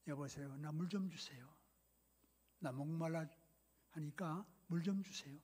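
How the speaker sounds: soft, low, gentle request; quiet approach. Acted. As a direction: approach softly and ask a small favor. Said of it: "조용히 다가가서". (조용하고 나지막하게 부탁하는 말투)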